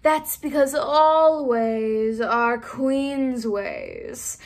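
slow, drawn-out cat voice; lazy Cheshire Cat speaking very slowly; Cheshire Cat moon. Acted. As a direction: purr it out slowly, sly and knowing. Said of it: "said the moon".

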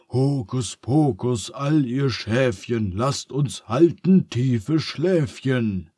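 chanted spell, slowing at the end; incantation. A narrator's reading. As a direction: intone the rhyme like a wizard. (feierlich gesprochener Zauberreim)

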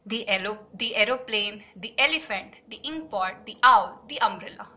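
each "the" said "thee". Shown as a demonstration. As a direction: list them with "the" rhyming with "see" before each vowel. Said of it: "దాన్ని ది అని అంటాం".

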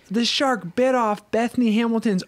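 put-on high, worked-up kid delivering proof; hammed-up dismay, pitched high; imitated upset child. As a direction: imitate a child's indignant outburst. Retells upset daughter's accusation.

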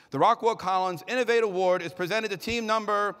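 loud, high arena announcer calling out each team. Acted. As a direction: boom every name across the arena like a hype announcer. Announces the award winner.

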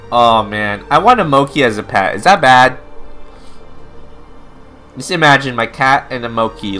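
ordinary delivery

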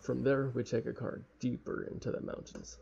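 ordinary talk, nothing marked

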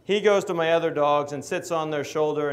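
usual speaking voice

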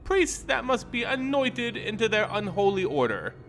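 high, purring vampire lady, proud and playful; Henrika the vampire. Acted. Vampire boasting to Odric about her mansion, high and smug.

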